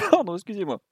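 choking, recovering. Real, not acted. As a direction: still catching breath after choking. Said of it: "je m'étouffe tout seul".

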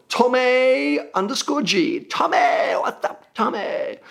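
high, drawn-out playful name call; playful shout-out. Pretend. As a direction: hail a listener in a hammed-up high call. Hammed-up shout-out to a listener.

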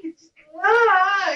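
slow, high ah mimicking her cry; a copied slow high moan; imitated ecstatic wail. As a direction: echo her wordless letting go. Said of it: "she's like, "I can't speak".

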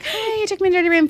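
high, singsong retold greeting; mock-sweet complaint. Guest returning to the front desk, mock-sweet and high.

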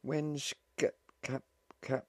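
high, faltering stammer; suppressed panic. A narrator's reading. Young wife asking about the next stop, stammering anxiously. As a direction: stumble over the word while fighting panic. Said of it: "she struggled to keep panic rising".

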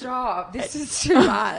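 high-pitched real incredulity; amused disbelief. Real, not acted. Listener overwhelmed by friend's story, high incredulous protest.

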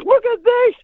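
high, aghast mock wail; Dave in dismay. Dave showing his torn chest that night, high comic dismay. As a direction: show off the bruise in comic horror. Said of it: "he said".

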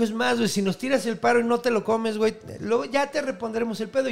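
lightly high duck voice offering a deal; duck bargaining. Acted. Mother duck offering the hero a deal, slightly high. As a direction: promise a favor in a little high voice.

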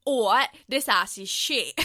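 loud, mock English accent; hammed-up bad English accent; American's English accent. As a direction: overdo an American actor's attempt at English. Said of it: "doing English accents".

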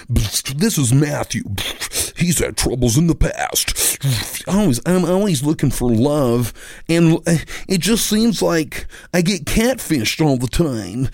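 high, slow, mock-sad British contestant; milk the sob story, slow and high; contestant backstory voice. Host introducing the contestant, mock-sad high backstory voice. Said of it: "Gives backstory about him".

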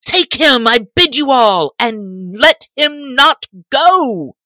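high, loud furious orders; angry sheriff shout. A narrator's reading. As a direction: command the men in a rage. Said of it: "cried the sheriff".

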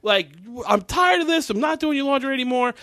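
high, strained mock scream; fed-up girl yelling at her family, high; imitating a scream. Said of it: "and she screams".

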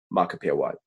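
imitated French waiter, pitched low; French accent. Waiter naming himself on the phone, in a French accent. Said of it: "French accent".

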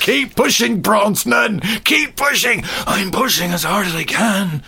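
high, strained comic shouting; mock strained yelling. Podcaster acting out two men pushing car halves together, high strained yell. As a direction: yell through a hammed-up heave of effort.

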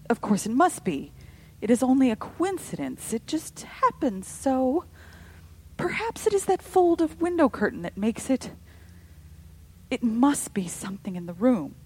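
loud, nervous self-convincing; anxious reassurance. A narrator's reading. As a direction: talk yourself out of fear. Missus Brigham talking herself calm.

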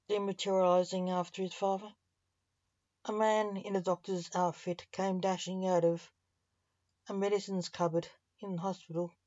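ordinary delivery, nothing marked

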